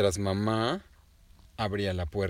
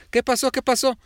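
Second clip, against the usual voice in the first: higher, louder alarmed mom; mamá alarmada; 14 semitones higher, 10 dB louder than usual. A narrator's reading. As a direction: rush in asking worried. (mamá alarmada, más aguda y fuerte)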